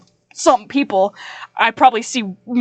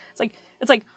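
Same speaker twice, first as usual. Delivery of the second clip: ordinary talk, nothing marked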